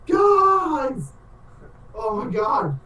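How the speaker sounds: very high, stretched shout of greeting; excited calling out. Acted. Player as Chunt waving at everybody, excited shout. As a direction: squeal it to friends across the room.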